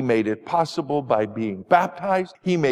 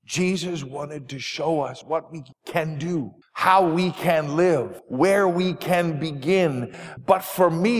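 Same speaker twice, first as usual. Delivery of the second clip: ordinary delivery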